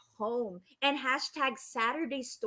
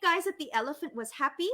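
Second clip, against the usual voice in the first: ordinary delivery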